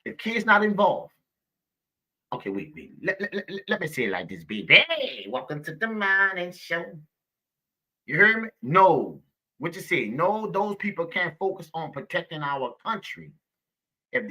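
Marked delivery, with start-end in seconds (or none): 4.9-8.5 a light put-on radio host voice; mock host greeting. Streamer playfully opening a pretend morning show, light host voice.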